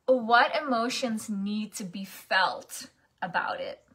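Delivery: ordinary delivery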